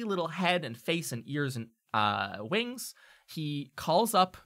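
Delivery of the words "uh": ordinary delivery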